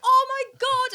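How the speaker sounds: high, loud, overjoyed exclamation; giddy squeal of delight, acted out; excited. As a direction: re-enact being thrilled beyond belief. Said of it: "I was so excited".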